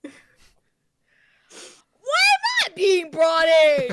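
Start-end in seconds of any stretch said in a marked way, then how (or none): 2.4-3.9 slow, loud outraged protest; indignant shout. Real, not acted. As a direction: protest loudly, offended. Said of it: "laughs at the outburst".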